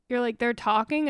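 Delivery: ordinary delivery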